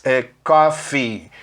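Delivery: coffee said slowly, ending in a clear "i"; coffee with ee sound. Shown as a demonstration. Teacher slowly modelling coffee with its final "i". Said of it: "os dois Es têm som de Is".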